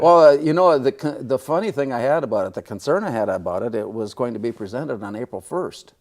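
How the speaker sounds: chuckling through the story of the award; amused laughing retelling. Real, not acted. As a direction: laugh it out, amused at the odd date. Laughing about April Fool's award.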